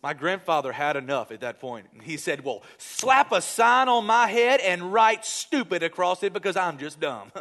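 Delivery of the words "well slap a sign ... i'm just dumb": fed-up retort. Retold or imitated.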